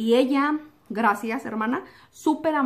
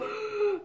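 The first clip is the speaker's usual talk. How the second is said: shocked gasp; 9 semitones higher, 7 dB quieter than usual. Retold or imitated. Reliving being hit.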